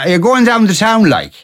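high lilting Irish Midlands accent, dropping on "like"; Ballinasloe accent. Shown as a demonstration. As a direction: ask it like a Ballinasloe local. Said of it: "in Ballinasloe, somebody would say".

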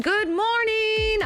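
slow, high, drawn-out good morning; excited greeting. Real, not acted. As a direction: sing out the hello to listeners. Presenter's big morning greeting.

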